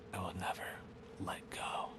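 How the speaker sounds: soft unvoiced threat; menacing whisper. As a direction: breathe the threat in the ear. Killer's menacing sign-off.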